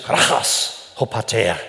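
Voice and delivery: slow demonstration of "krachas"; croaking Greek word. Shown as a demonstration. Preacher shows congregation the Greek word, slow lightly croaked "krachas". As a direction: sound out "krachas" slowly with a rough ch. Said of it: "da steckt das Wort krächzen drin".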